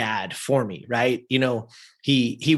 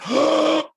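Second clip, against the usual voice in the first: sharp, high intake of breath; acting out breath knocked away; shocked gasp; 4 dB louder than usual. Son hearing coach's rejection, shocked gasp. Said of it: "Take your breath away".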